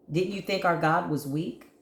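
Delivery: usual speaking voice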